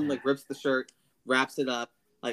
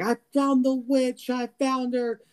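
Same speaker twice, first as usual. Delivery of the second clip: much higher, slow triumphant cry; Solomon gloating; 12 semitones higher, 4 dB louder, slower than usual. Retold or imitated. Solomon grabbing Sarah and crying to the town, much higher and slow in triumph. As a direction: crow it out like a captor who caught his prey. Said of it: "snatches her by the back of the head and it's like".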